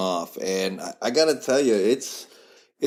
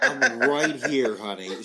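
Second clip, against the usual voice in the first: laughing, drawn-out mock reminder; mock protest; 2 semitones higher, slower than usual. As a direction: playfully plead for your wife's attention, slowly. Husband mock-protests wife's fandom.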